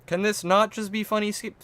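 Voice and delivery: high put-on pleading voice, cut short; mock plea. Streamer pleading about the game, high mock voice. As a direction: start a high silly plea, then abandon it.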